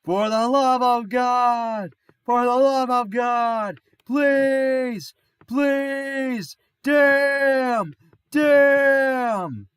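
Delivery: drawn-out, high, very loud pleading wail; hammed-up desperate scream; meme yelling. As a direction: yell each word long and high, like begging the heavens.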